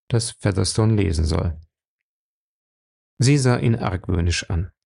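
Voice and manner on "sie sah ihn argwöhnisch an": normal talking voice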